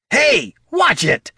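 very high, slow holler; drawn-out high-pitched yell; indignant shout. Acted. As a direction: call out in outraged protest from afar. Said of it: "shouted Bert".

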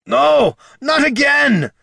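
high, loud cry of protest; desperate shout. Acted. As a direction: yell it as the chance slips away. Dwarf's desperate cry racing to portal.

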